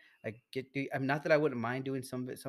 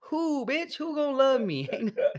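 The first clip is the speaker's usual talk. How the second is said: high, loud mock rant; 9 semitones higher, 9 dB louder than usual. Friend ranting to his podcast cohost, high and loud. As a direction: voice jumps up, loud and laughing.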